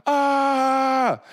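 long, high, loud "aah"; imitated basketball heckle; taunting crowd yell. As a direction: hold a loud jeering yell. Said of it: "you're taunting the other team".

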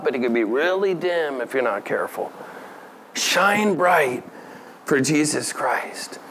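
usual speaking voice

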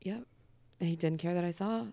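normal talking voice

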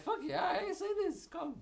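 eager high-pitched imitated reply; high mock reply. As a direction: squeak it out like an eager yes.

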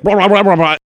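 loud, high frog-creature gibberish; bullywug fit. Acted. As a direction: babble like a frog throwing a fit. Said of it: "bullywugs start throwing a fit".

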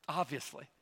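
Obviously said low and soft, almost to himself; soft aside. Shown as a demonstration. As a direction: drop Obviously to a quiet murmur. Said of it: "Obviously, I will be with thee".

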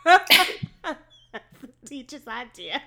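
laughing harder. Real, not acted. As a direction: break up laughing on it.

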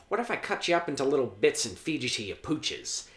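taunting villain voice, sinking into a rasping whisper at the end; Joker imitation. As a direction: play a menacing villain toying with his victim. Acting out Joker threatening the Chechen.

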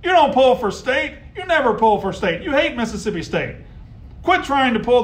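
high, loud scolding of rival fans; heated rant. Real, not acted. Radio host scolding Ole Miss fans, loud and high. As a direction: call them out, voice up and loud.

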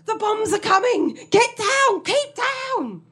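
put-on high, urgent old soldier shouting orders; panicked soldier. Confused old man yelling trench orders to his carer. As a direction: warn of falling bombs in panic. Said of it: "he starts mumbling".

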